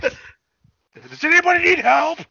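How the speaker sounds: slow, high and loud creepy offer; creepy helper. Acted. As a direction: play the shady guy at the beach, drawn out and high. Acting creepy beach guy offering help.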